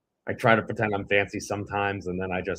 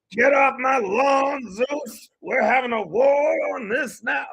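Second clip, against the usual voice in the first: much higher, slow hammed-up cartoon rooster shout; Foghorn Leghorn; 14 semitones higher, 3 dB louder, slower than usual. Kronos yelling at Zeus, hammed-up cartoon rooster shout. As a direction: yell like a blustering cartoon rooster. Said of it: "I transformed into Foghorn Leghorn there".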